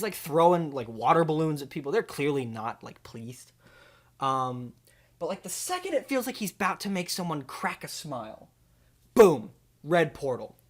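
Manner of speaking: ordinary talk, nothing marked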